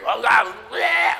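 mock your own scratchy preacher yell; loud, gravelly self-parody shout. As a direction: holler it like a raspy preacher. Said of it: "my scratchy, gravelly voice in your head".

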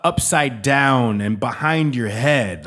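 ordinary delivery

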